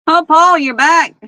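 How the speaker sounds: high, loud happy surprise; light up as he returns; excited greeting. Real, not acted. Greeting someone arriving.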